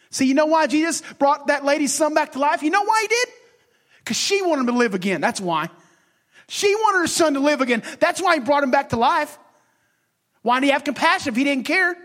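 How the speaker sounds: high, building preacher's questions; rising preaching. Acted. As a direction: press the crowd with each question.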